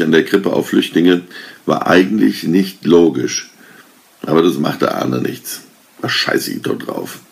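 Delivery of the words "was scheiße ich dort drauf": hissed under his breath, much lower and softer; angry mutter. A narrator's reading. Arne grumbling at the nativity scene, hissed mutter. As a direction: spit it out quietly, not yet shouting. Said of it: "Diesmal schrie er es laut heraus".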